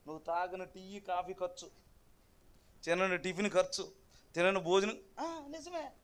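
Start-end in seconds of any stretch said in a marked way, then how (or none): none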